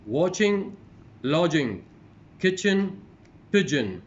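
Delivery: loud, separated words, rising then falling in pairs; teacher's model pronunciation, each word on its own; word-list reading. Shown as a demonstration. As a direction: read out minimal pairs clearly for a class. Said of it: "going to read these, the words".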